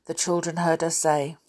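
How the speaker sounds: usual speaking voice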